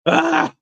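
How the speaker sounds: a sudden high squeal of excitement; inner excited squeal. Retold or imitated. Fan meets the Figpen CEO, high squeal. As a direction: squeal with glee inside your head. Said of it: "I'm thinking in my head".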